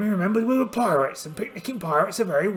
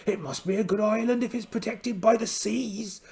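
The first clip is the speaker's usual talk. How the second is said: ordinary talk, nothing marked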